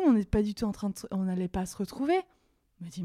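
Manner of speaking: normal talking voice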